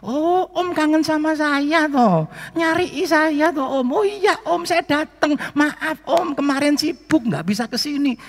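high, slow, sing-song coaxing; cheerful gentle reply. Retold or imitated. Pastor gently humours the sick man, high and slow. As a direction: talk to him like a dear old uncle.

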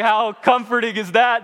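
high, loud sarcastic retort; mock indignation. Panicked climber answering his calm brother, indignant. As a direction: throw it back in exasperated disbelief.

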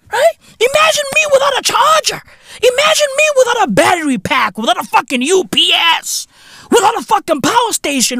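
high, rapid wailing rant; mock despair. Comedian imagining life without a charger, high mock despair. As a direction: wail it fast and high in mock panic.

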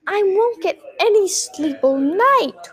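complaining, swooping whine; whining. A narrator's reading. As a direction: whine like a fretful little girl. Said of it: "whined Tessie".

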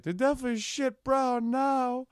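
high teasing jab; mocking high voice. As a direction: squeak it out to mock him. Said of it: "and I was like".

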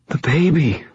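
put-on high, worried girl's voice; anxious. A narrator's reading. Injured pregnant girl remembers her baby, anxious. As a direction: pitch it high with sudden worry.

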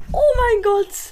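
high shrieking staged disbelief; fake excited screaming. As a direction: scream it like a jackpot win. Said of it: "dass es ein Prank war".

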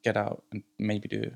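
normal talking voice

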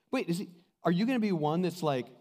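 normal talking voice

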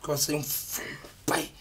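hushed, unvoiced retelling; whisper. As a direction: breathe it like a secret. Retelling son's near-swear.

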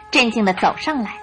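usual speaking voice

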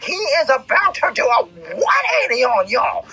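high, shouted preacher's cry; preaching shout. Acted. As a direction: proclaim it at the top of your voice. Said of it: "I'm gonna say that again".

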